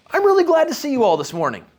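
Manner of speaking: high, bright and sincerely glad; really mean that you're happy to see them; genuinely glad. Shown as a demonstration. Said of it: "I turn around and I look at you all this morning and I go".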